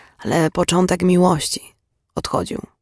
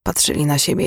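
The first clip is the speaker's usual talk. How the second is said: usual speaking voice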